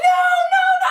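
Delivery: high-pitched mock wail of refusal; imitating a mother's anguished cry; mock distressed mother. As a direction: wail it like a horrified mom. Mother's distressed reaction acted out.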